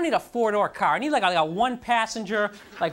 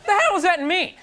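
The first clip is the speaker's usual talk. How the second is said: baffled outburst; 9 semitones higher, 6 dB louder than usual. Retold or imitated. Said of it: "I was like".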